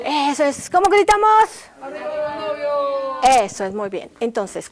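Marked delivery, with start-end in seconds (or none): 0.7-1.5 loud high prompt to the class; loud cue. Real, not acted. Teacher prompts the class to shout, loud and high. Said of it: "todos los demás vamos a gritar". 1.8-3.4 shout. Shown as a demonstration.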